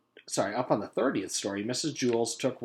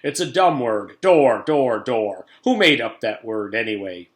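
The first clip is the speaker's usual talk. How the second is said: loud, scornful rant; 10 dB louder than usual. Acted. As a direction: spit out the word in contempt. Principal ranting.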